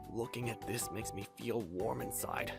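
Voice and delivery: soft, low murmur to oneself; quietly touched, low and soft; hushed admiration. Acted. Student gazing at the artwork, hushed admiration. Remembered words before the artwork.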